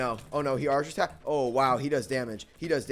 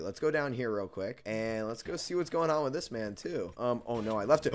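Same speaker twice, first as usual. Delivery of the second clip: ordinary delivery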